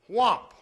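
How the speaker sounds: loud imitated frog gulp; a big whomp like a frog filling up; a loud frog noise. Shown as a demonstration. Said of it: "How many of you have seen a frog breathe?".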